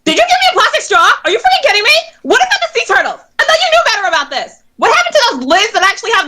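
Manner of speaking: high-pitched, mostly fast indignant questioning; indignant outrage. Acted. Customer scolding barista over plastic straw, indignant.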